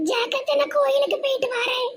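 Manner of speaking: high, soft, cried-out voice; voice spoilt by crying. Acted. Telling elder she's leaving for the temple, tearful. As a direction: speak softly, worn out from weeping. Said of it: "அழுது அழுது குரலே கெட்டி போச்சு".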